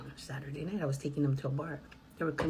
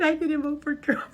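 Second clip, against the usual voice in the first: retold line; 10 semitones higher, 8 dB louder than usual. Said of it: "He said".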